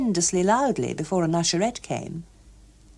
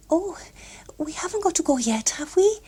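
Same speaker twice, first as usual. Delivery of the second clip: put-on high, pleading girl's voice; pleading young woman; 11 semitones higher than usual. A narrator's reading. Gloria begging to stay for the film, high and pleading. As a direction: coax him to stay, high.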